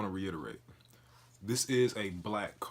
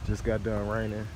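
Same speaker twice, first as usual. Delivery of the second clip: normal talking voice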